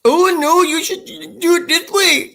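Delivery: high, loud put-on Indian accent; hammed-up Indian accent; imitating Indian accent. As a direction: mimic the Indian character's one-liner in his accent. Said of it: "doing one-liners like".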